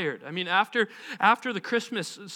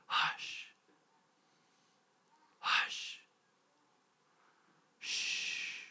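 soft whisper fading to a shush; whispered hush; 12 dB quieter, much slower than usual. A narrator's reading. Jesus stills wind and waves, whispering softly. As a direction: whisper each hush as a calming command. Repeats Jesus hushing the storm.

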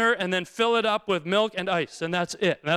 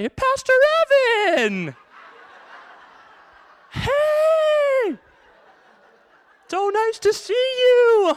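much higher, loud, slow squealing welcome; overjoyed greeting; 6 dB louder, much slower than usual. Retold or imitated. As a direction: gush like a fan spotting the pastor. Barista greets pastor delightedly.

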